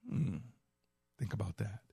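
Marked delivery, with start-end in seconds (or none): none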